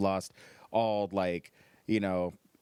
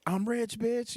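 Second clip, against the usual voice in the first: put-on drunk fan brag; 13 semitones higher, much slower than usual. Drunk fans grabbing mic.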